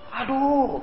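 high, drawn-out mock "ow"; put-on high-pitched complaint; acted cry of pain. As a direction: imitate someone yelping over a small hurt. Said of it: "Kalau sekarang kita mengucapkan apa".

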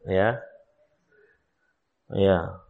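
usual speaking voice

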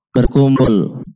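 usual speaking voice

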